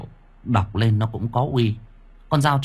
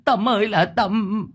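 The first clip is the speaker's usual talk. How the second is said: lamenting wail; 9 semitones higher than usual. A narrator's reading. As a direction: wail then curse him. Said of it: "kêu lên". (cậu Sáu than vãn rồi chửi to)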